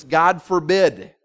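slow, loud "God forbid"; emphatic. Acted. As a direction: stretch out "forbid" with weight. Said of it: "Some translations render this".

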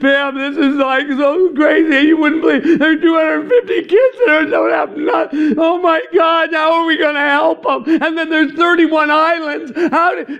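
high, loud, distressed pleading; overwhelmed missionary calling his wife in distress; retold breakdown. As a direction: pour out panic to your wife on the phone. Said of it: "I just started crying".

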